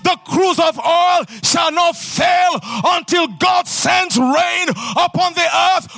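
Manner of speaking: rousing, declaiming sermon voice; preaching at full force. Acted. Preacher proclaiming blessings to the congregation, full-force preaching.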